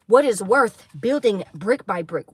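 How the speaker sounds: ordinary delivery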